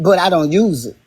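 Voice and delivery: normal talking voice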